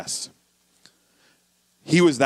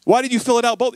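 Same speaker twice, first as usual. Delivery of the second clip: exasperated boss; 8 semitones higher than usual. Retold or imitated. Boss scolding Edmund.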